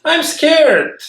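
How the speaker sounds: high, small, mock-scared chirp; a little vegetable squeaking its fear; chirpy scared. Charlie chirps his fear to Petunia, high and timid. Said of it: "chippered Charlie to Petunia".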